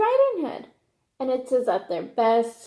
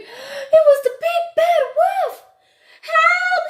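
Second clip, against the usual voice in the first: much higher, much louder terrified scream; screaming for help; 8 dB louder, slower than usual. Acted. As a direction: shriek it out as if the wolf just appeared. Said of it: "pulled back the covers and screamed".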